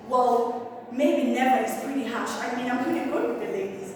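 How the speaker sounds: usual speaking voice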